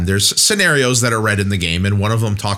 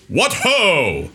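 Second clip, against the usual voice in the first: mock knight challenge; 8 semitones higher than usual. Shown as a demonstration. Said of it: "do that with the louder volume".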